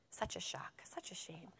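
barely voiced storybook whisper; very soft, breath-only aside; hushed whisper. A narrator's reading. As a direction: whisper it in mock shock. Hushed reading at story's climax.

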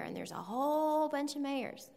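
put-on high, sing-song voice for little kids; kindergarten teacher voice. Shown as a demonstration. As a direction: talk like you're explaining to five-year-olds. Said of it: "I might talk to you in my kindergarten voice".

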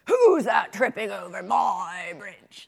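loud, slow troll roar. A narrator's reading. Troll challenging the goat on his bridge, roaring. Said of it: "Roared the troll".

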